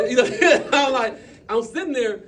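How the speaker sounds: loud laughing retelling. Real, not acted. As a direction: laugh through the words. Laughing at the memory.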